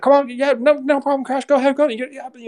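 pitching coach imitation to Crash; old-school coach. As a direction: mimic a tobacco-chewing pitching coach egging him on. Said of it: "he's old school baseball".

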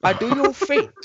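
exaggerated high Chinese accent. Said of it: "I may do it in a Chinese accent".

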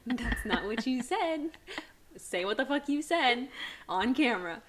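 loud and half-laughing, pressing him; laughing challenge. Retold or imitated. Wife laughing at husband, demanding he repeat it on camera.

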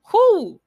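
big high whew of release; loud, high exhaled whew; relieved whew. Real, not acted. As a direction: blow it out like a weight just lifted. Relieved exhale after pep talk.

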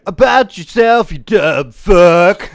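high, loud, very slow sing-song insult; hammed-up yell. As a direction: belt it out mockingly, stretched. Acted insult in quote.

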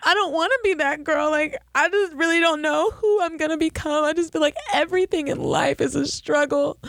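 high, whiny fake distress; mock tearful pleading. Telling her rich friend a fake sob story, high and whiny.

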